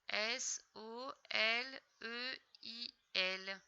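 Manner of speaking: S O L E I L, each letter slowly apart; slow careful spelling for a child; spelled out. Shown as a demonstration. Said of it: "spelling letter by letter".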